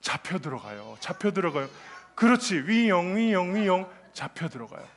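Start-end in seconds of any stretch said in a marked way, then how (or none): 0.0-0.9 much lower, quieter mock answer. Shown as a demonstration. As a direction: drop the voice low and soft for the answer. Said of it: "제자들처럼 먹으면 어떻게 될까요?".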